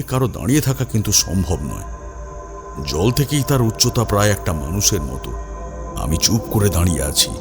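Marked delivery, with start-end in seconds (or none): none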